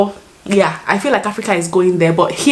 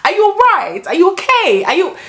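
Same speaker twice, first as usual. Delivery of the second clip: very high, sing-song mock British accent; attempted British accent; 11 semitones higher than usual. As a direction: put on a British lilt, pitched way up. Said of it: "I'm trying to use the accent".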